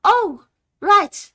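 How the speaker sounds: high, loud "oh, right"; caught-out parents. Acted. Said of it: "they both glance to each other and then go".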